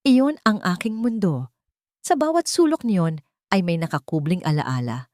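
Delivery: ordinary delivery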